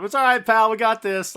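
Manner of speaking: hammed-up buddy pep talk; giving Scott a thumbs up, a cheery mock reassurance; reassuring pal. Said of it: "giving Scott a thumbs up".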